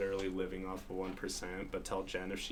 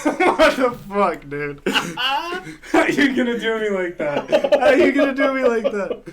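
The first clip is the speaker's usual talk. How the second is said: much higher, louder, laughing outrage; laughing protest; 13 semitones higher, 16 dB louder, slower than usual. Real, not acted. Friend reacting to a terrible pun, laughing protest. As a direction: groan it out while cracking up.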